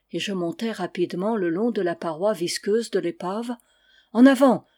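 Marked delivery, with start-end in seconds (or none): 4.1-4.7 loud rallying shout from the deck; hearty call of a ghostly sailor. Acted. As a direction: bark it out to urge him on. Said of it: "cria Kerouel".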